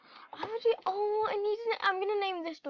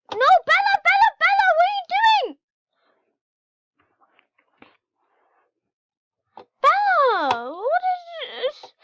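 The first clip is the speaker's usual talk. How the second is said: high, loud frantic calls; frantic calling; 9 dB louder than usual. Real, not acted. Player panicking over her cat, frantic high calls. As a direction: plead with the cat to stop.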